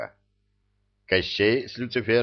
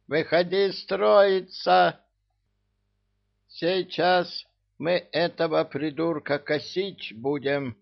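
squeaky commander; 8 semitones higher than usual. Acted. Little commander rallies troops.

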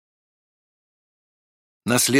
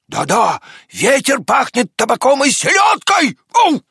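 high, loud barking-dog excitement; excited shouting; 11 semitones higher, 5 dB louder than usual. A narrator's reading. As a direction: cry out the great news to wake someone. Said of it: "закричала она". (взволнованно кричит высоким голосом)

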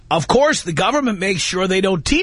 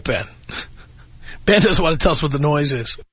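ordinary talk

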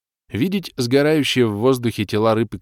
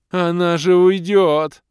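high, loud, slow wail; despairing yell; 9 semitones higher, 6 dB louder, slower than usual. A narrator's reading. Doc yelling as the demoness leaves, high loud wail. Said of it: "заорал Док".

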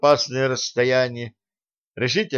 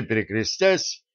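usual speaking voice

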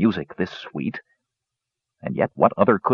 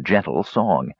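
ordinary delivery, nothing marked

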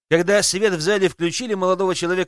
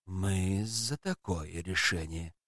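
low emperor voice; 8 semitones lower, 9 dB quieter, slower than usual. Acted.

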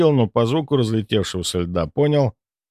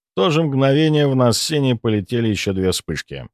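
ordinary delivery, nothing marked

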